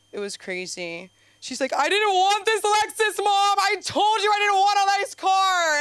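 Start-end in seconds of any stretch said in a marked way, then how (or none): 1.7-5.8 high, loud whiny shout; mother shouting. Retold or imitated. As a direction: yell it like a sulking mom. Mom shouting at grandma.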